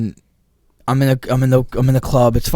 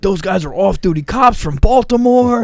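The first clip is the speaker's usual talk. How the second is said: higher, urgent friend's voice on the call; alarmed cop buddy; 9 semitones higher, 4 dB louder than usual. Retold or imitated. Cop buddy calls to warn him: higher alarmed voice. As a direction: warn him he's in trouble. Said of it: "He's like".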